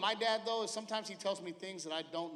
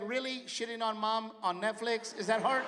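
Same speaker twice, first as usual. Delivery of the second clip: sneering son; 5 semitones higher than usual. Retold or imitated. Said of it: "My son's gonna be like".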